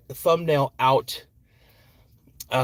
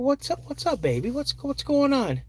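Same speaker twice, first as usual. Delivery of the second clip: higher, soft fatherly concern; gentle dad; 11 semitones higher, 3 dB quieter than usual. Retold or imitated. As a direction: coax her gently like a worried dad. Dad soothing upset daughter.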